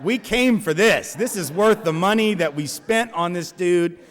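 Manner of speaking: high, loud and slow with a laugh; hooting committee members sure a showdown is coming; gleeful committee. Retold or imitated. Eager committee relishing the fight, loud gleeful glee. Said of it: "they are just like".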